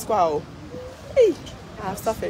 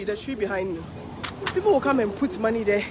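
ordinary talk

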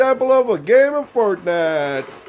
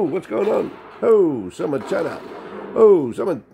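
ordinary delivery

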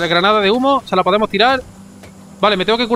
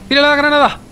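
grenade warning yell; 7 semitones higher, 3 dB louder than usual. Real, not acted. Urging to throw grenade back.

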